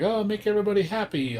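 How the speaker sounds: quick, high, happy cheer; high-pitched mock cheerfulness at a quick clip; peppy pop idol. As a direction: imitate a bubbly bard cheering everyone up. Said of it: "sounding really happy".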